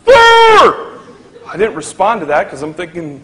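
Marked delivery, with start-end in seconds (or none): 0.0-1.0 high, loud call across the cabin; yelling a nickname at a tall passenger; yelled name. Retold or imitated. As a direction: flight attendant shouting down the aisle. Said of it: "She yells down over everybody".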